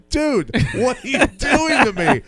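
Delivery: high, slow, half-laughing plea of disbelief; a mock-wounded whine, breaking into a laugh. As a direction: retell the moment, laughing at the betrayal. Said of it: "And I go".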